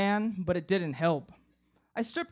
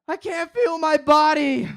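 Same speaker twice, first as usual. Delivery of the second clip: high, loud, frantic wail; panicked cry; 8 semitones higher, 9 dB louder than usual. Retold or imitated. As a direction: scream it like you're losing control.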